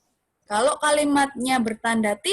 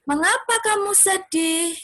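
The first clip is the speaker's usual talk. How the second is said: "Mengapa kamu sedih?" said high, rising like a question; rising question tune; 7 semitones higher than usual. Shown as a demonstration. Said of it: "Jadi intonasinya itu naik". (pertanyaan dengan intonasi naik)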